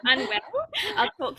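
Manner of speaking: a real giggle as she admits it; laughing. Real, not acted. Telling the interviewer about getting unwell, laughing.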